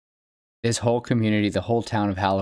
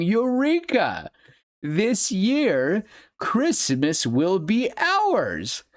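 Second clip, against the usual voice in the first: triumphant exclaim; 10 semitones higher, much slower than usual. Retold or imitated. Jack's triumphant song conclusion.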